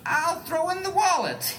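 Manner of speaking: high, slow pleading bargain; put-on Elliot voice. Elliot offering wallet.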